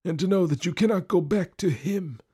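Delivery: put-on high-pitched spiteful old husband; spiteful old lord. Jealous lord taunts his wife, spiteful.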